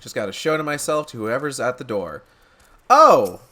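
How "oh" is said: loud, high burst of surprise; surprised exclamation. Real, not acted. Player coming upon whoever's at the door, loud surprised oh. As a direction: exclaim loudly, pitched up.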